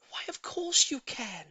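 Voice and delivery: high, breathy eager reply; delighted lady's breathless yes; eager cry. A narrator's reading. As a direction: answer as if you can't wait. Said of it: "Missus Silver cried".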